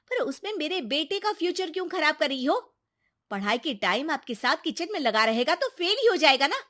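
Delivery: high-pitched, sharp questioning; high, scornful scolding woman; accusing daughter-in-law. Acted. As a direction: confront mother-in-law with indignant complaints. Daughter-in-law confronts grandmother.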